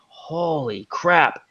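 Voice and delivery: drawn-out, hushed disbelief; stunned awe. Acted. Snake catcher first seeing the huge snake, stunned awe. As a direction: let it out very slowly, softly stunned.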